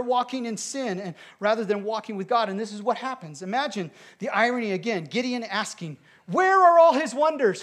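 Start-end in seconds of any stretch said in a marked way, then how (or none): 6.3-7.6 high, loud exclamation; voicing Gideon's doubting cry; mock incredulous. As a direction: throw the question up in disbelief. Gideon's mocking question.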